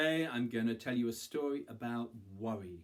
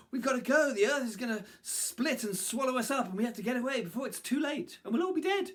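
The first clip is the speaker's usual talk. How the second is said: high, loud panicked monkeys; frantic warning; 12 semitones higher, 6 dB louder than usual. A narrator's reading. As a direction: urge them to flee for their lives. Monkeys warn rabbits in panic.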